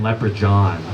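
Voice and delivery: Leprechaun said as "Lepre-John"; odd pronunciation. Retold or imitated. Parents announcing their kid's unique name, Leprechaun pronounced "Lepre-John". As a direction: say Leprechaun with "john" for its last syllable. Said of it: "a unique name for our kid".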